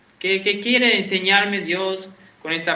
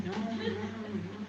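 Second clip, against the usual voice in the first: susurro imitado; 2 semitones higher, 17 dB quieter, much slower than usual. Retold or imitated. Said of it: "ella me hacía así".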